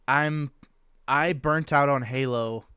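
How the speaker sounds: ordinary delivery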